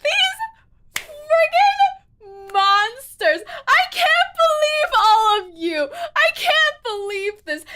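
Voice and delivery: high, loud and drawn out; outraged wail. Real, not acted. Player scolds the chat for tricking them, outraged wail.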